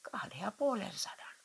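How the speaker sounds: guilty whisper. Acted. Wife confesses the real sum to angry husband in a whisper. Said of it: "kuiskasi Vieno".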